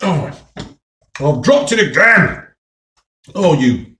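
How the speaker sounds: real exasperated cry, peaking on the last word; exasperated outburst. Real, not acted. Eater who dropped food in the dip, exasperated cry. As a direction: cry out in frustration at your own clumsiness.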